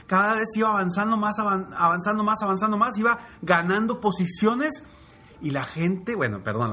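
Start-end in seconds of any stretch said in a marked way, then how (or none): none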